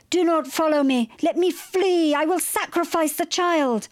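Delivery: high, loud declamation; desperate threat. A narrator's reading. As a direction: cry out a desperate warning. Desperate threat from the fleeing boat.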